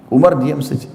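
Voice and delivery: ordinary talk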